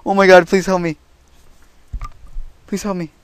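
high loud scared begging; frightened pleading. Real, not acted. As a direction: plead for help, panicked. Pleading after chase.

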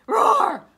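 loud playful roar; dinosaur roar. Pretend. Dinosaur roars at mailman.

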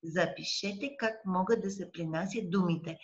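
ordinary delivery

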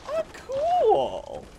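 very high, loud delighted cry; thrilled squeal. Real, not acted. Gamer delighted by a new item, high excited squeal. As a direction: gush over the find.

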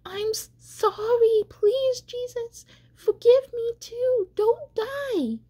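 very high, desperate wail of pleading; screamed plea. A narrator's reading. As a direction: beg in a high, terrified cry. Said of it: "He screamed".

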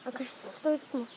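hushed quick whisper; whispering while hiding. Real, not acted. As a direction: keep it hushed so you're not found. Hiding from the chaser.

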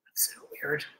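a startled whisper under her breath; hushed, spooked murmur. Real, not acted. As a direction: whisper it, unsettled by the noise. Spooked by strange music.

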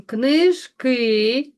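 книжки said with a long final КИ; stress on second syllable. Shown as a demonstration. Teacher marking unstressed vowels for pupils, stressed syllable stretched. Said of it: "і ненаголошений".

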